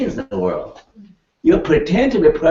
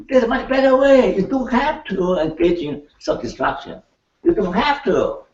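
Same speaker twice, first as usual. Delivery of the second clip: angry demo; 8 semitones higher than usual. Shown as a demonstration. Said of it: "I'm angry now".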